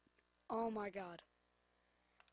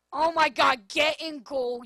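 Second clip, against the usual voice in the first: angry yell; 2 semitones higher, 13 dB louder than usual. Real, not acted. Yelling at own goalkeeper.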